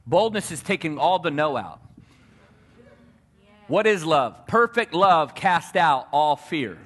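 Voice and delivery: loud, rising preacher's punch; preacher landing the point on fear; preaching. Acted. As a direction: drive it home from the pulpit, slowing on the last line. Preaching builds.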